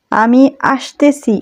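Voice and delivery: drawn-out teaching voice, word by word; slow, deliberate model sentence; slow demo. Shown as a demonstration. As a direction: spell it out slowly for learners. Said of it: "আবারও লক্ষ্য করুন guys".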